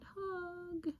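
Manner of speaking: very high, soft, drawn-out call; a small, plaintive far-away call; calling out softly. A narrator's reading. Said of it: "He's calling out".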